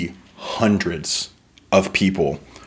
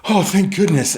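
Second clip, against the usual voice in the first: higher, relieved sigh of a voice; relieved Simone; 10 semitones higher than usual. Acted. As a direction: let the relief lift the voice up. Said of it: "Simone turns and she goes".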